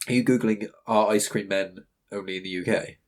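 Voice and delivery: ordinary talk, nothing marked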